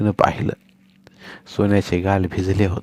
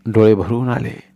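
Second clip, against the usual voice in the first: plain reading voice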